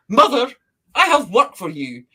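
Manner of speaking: a touch of a commanding god voice; authoritative. Acted. Father of all spirits handing Sun Mother her work, lightly commanding. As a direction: give orders like a boss. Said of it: "he sounds like a really authoritative".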